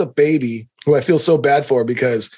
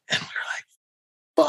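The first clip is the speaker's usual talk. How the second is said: whisper; 11 semitones higher, 8 dB quieter, slower than usual. Real, not acted. Whispered lead-in.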